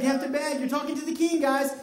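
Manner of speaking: usual speaking voice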